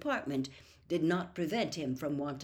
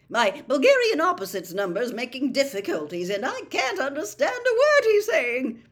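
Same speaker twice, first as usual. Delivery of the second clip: loud, higher, hearty chatter; breezy, jovial complaint at full volume; big cheerful voice; cheerful booming; 6 semitones higher, 9 dB louder than usual. A narrator's reading. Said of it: "Bagman turned most cheerfully back".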